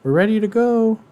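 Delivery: high mock oblivious chatter. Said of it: "just like".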